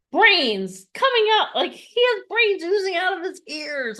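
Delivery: gushing praise. Real, not acted. Praising teammate.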